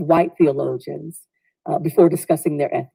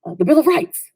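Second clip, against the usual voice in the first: higher, eager called-out answers; class yelling out; 9 semitones higher than usual. Retold or imitated. Class shouts answers to the professor, mild mock yell. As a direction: shout them out like a keen class. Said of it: "we all, we yell out".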